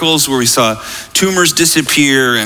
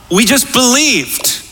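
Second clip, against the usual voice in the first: "We just believed" high and slow; emphatic repeat; 10 semitones higher, slower than usual. Real, not acted. Preacher drives home the point to congregation, "We just believed" slow and emphatic. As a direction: draw out "believed" at a high pitch.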